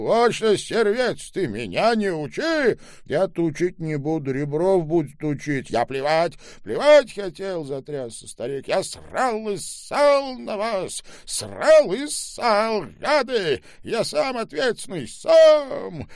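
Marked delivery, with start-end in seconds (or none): 0.0-7.6 put-on much higher, louder old man ranting; almost yell it at the boy; old man lashing out at the boy, near shouting. A narrator's reading. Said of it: "почти выкрикнул". 8.7-16.1 much higher, louder, slow furious rant; raging old man. A narrator's reading.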